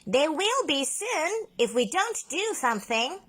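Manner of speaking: high, serious octopus warning; lightly voiced octopus. A narrator's reading. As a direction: warn that time is short. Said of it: "said Ollie the octopus".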